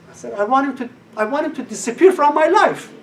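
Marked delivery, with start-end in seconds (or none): 0.3-0.9 emphatic plea. Retold or imitated. Retold plea to teacher. 1.2-2.9 higher and louder wish; emphatic wish. Retold or imitated. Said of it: "Listen to these words".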